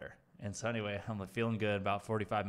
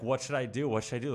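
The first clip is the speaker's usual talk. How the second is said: retold panic; 4 semitones higher, 5 dB louder than usual. Retold panic over the dose.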